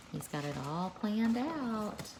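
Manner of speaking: usual speaking voice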